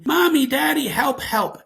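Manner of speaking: high, loud cry for parents; mock panicked kid yell; retold child's shouting. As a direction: imitate a frightened child calling out. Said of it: "Henry starts shouting".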